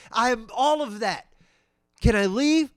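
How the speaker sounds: high, loud, fed-up plea; mock-exasperated customer. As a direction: push loudly to get away. Exasperated customer trying to leave.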